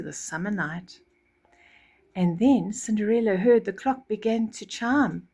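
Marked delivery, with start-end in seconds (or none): none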